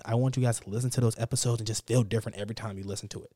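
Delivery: ordinary talk, nothing marked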